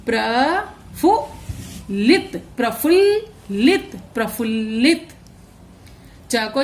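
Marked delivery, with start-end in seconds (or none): 0.1-2.4 प्रफुल्लित drawn out very slowly; प्रफुल्लित stretched slowly; slow sounding out. Shown as a demonstration. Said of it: "आधा ल को हम थोड़ा सा बोलेंगे". 2.6-4.0 प्रफुल्लित with a clearly doubled ल, pitched higher; प्रफुल्लित with ल्ल held, short then full; half and full l. Shown as a demonstration. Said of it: "आधा ल को हम थोड़ा सा बोलेंगे और पूरा ल पूरा".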